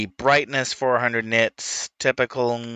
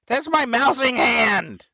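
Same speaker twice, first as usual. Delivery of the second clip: high, loud slow yelp; startled yelp; 5 dB louder, slower than usual. Real, not acted. Owner yelping at the cat grabbing his hand.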